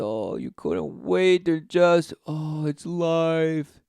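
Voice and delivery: slow, drawn-out dreamy mock voice; mock boasting woman. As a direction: parody a smug woman, stretching each word. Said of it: "You boast in your menstrual blood and say".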